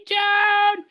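very high-pitched imitation of a ringtone; mock ringtone. Friend mocking the unsilenced phone, high mock-ringtone voice. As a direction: squeal it out like a phone blaring.

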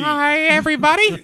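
squeaky, very high cartoon mouse; Mickey Mouse voice. [name] answers the mockery in a squeaky Mickey Mouse voice. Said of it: "I don't sound like Mickey Mouse".

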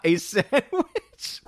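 word breaking into a high laugh; laughing while reading. Real, not acted. Host reading out the hot dog ruling, laughing. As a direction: crack up mid-sentence, pitch shooting up.

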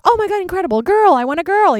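high, loud gushing delight; over-the-top thrilled gush; gushing excitement. Retold or imitated. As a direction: squeal with eager joy. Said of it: "I'm like".

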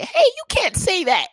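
high, loud scolding voice; high, loud indignant scold; mock scolding girl. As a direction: imitate the girl telling him off.

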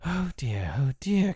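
exasperated lament, rising in pitch; high-pitched dismay; crying out. A narrator's reading. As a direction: lament it like a harassed little mother. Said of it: "cried Wendy".

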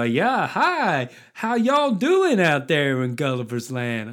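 mock a theme park cowgirl's bad American accent; rubbish American accent. Theme park cowgirl greeting visitors, in a rubbish American accent. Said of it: "pretend to be American and do it with a kind of rubbish accent".

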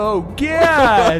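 loud, high, slow pitchman shout; hammy infomercial pitchman yell; Billy Mays. Acted. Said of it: "Billy Mays".

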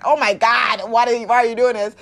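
loud, pleading outburst from the body; body crying out. Pretend. The body pleading with its lazy owner, loud and frantic. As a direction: yell it like the body begging you to stop.

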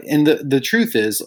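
ordinary delivery

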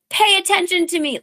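high-pitched mock plea for attention; pick-me girl. Mocking herself as the fiance seeking notice, pick-me girl. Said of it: "such a pick-me girl".

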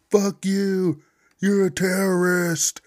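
high, loud, slowed hurled insult; angry insult. Retold or imitated. Accuser yelling at the unvaccinated guy, high and loud. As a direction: spit it out, furious but hammed-up.

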